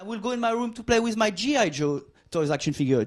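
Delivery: normal talking voice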